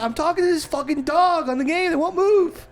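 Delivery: high, worked-up retold complaint; mock outrage at a game dog; exasperated explanation.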